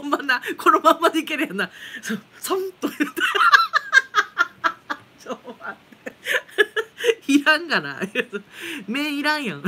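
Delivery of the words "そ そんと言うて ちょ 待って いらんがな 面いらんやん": talking through bursts of real laughter; can barely get words out for laughing; laughing fit. Real, not acted. Viewer joke sets off laughter. (笑い転げながら)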